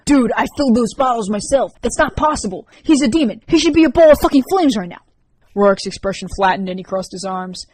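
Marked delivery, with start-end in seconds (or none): 0.1-5.0 high, loud, frantic protest; a boy insisting his trick should have worked; agitated teen Sam. Acted. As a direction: Sam yelling in disbelief at the demon.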